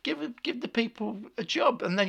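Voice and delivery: high, fast exasperated complaint; worked-up outburst of exasperation; angry rant. Real, not acted. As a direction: rant indignantly, pitch shooting up. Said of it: "he's so angry".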